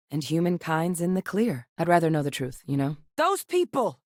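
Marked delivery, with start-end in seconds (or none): 3.2-4.0 high-pitched anguished outcry; distressed. Acted. Lamenting how it went down, distressed. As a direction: cry out, then protest in dismay.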